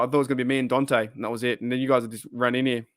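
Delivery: usual speaking voice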